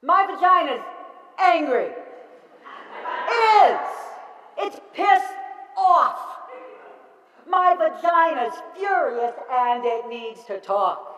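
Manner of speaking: loud, high, emphatic outrage; acted anger. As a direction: punch out each phrase in performed fury. Acted angry monologue, names the anger.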